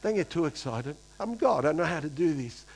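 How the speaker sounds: a hint of Jesus calmly saying tone it down; laid-back Jesus. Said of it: "do you think Jesus was like".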